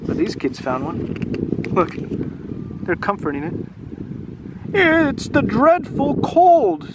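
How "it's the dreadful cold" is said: much higher, slow, sniffly sad voice; the sick dragon explains his cold, sniffling and slow. Acted. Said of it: "sniffed the dragon".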